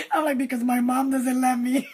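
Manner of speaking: barely holding in real laughter; laughing. Real, not acted. Dater joking to her friend about mom, laughing. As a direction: crack up while saying it.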